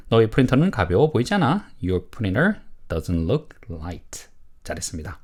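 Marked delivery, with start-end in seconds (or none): 1.8-4.3 slow, word-by-word model statement; model reading. Shown as a demonstration. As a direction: read slowly for learners to repeat. Teacher models sentence for repetition. (천천히 또박또박 읽는 시범)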